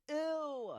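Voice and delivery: long, high squeal of disgust. Real, not acted. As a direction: draw out a high-pitched ew. Reacts to mention of stink bugs.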